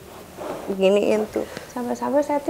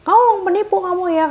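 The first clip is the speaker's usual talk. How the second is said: higher, louder accusing; angry accusation; 8 semitones higher, 4 dB louder than usual. Retold or imitated. Husband calling the technician a fraud, angry. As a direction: spit out the accusation.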